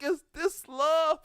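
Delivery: high, loud, slow dreamy question; dazed wondering. Retold or imitated. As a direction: ask it again, dazed and drawn out. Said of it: "I was like".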